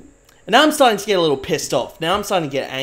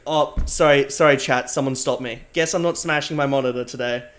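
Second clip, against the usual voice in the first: normal talking voice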